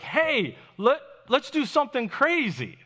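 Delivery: high, loud, eager outburst; excited suggestion. Acted. Peter to the others in a storm, excited proposal. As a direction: pitch a wild idea excitedly.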